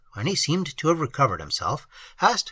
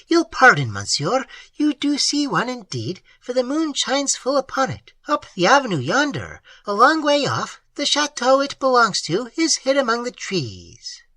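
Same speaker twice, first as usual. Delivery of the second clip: put-on higher, earnest voice for the old host; higher voice of a plain-spoken country host; old peasant host; 10 semitones higher than usual. A narrator's reading. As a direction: voice the simple host, eager to tell. Host points out the turret.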